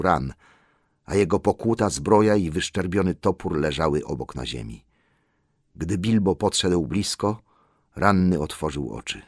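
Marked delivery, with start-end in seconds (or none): none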